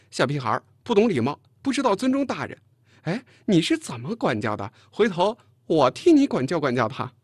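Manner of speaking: loud, high scolding tirade; blustering indignation. A narrator's reading. Chen Jing complaining about the rude girl, loud bluster. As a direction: rant with offended dignity. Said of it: "陈静咋呼了一声".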